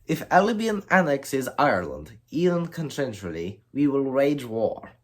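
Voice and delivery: put-on French accent. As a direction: play the EU's threat in a French accent. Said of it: "Sorry for that French accent".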